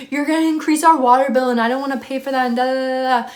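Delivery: high, rushed mocking imitation; mimicked complaining roommate. Roommate griping about laundry, in a fast mocking imitation. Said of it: "she would be like".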